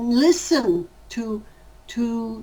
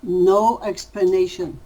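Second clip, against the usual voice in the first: ordinary delivery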